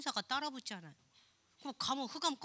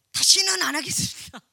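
hammed-up loud vow; 4 semitones higher, 15 dB louder than usual. Mock repentant prayer quoted.

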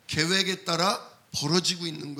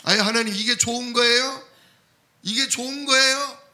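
high, loud disbelieving cry to God; louder and higher on the repeat, as if you can't believe it; incredulous protest; 5 semitones higher, 5 dB louder than usual. Pretend. 하나님께 항의하는 흉내. (하나님께 따지듯, 높고 크게 믿기지 않는다는 외침)

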